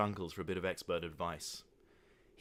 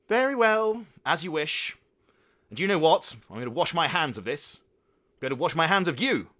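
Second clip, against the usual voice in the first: loud, high, grandly indignant; disowning father; 8 semitones higher, 10 dB louder than usual. Acted. Father loudly disowning his son. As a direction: wash your hands of him.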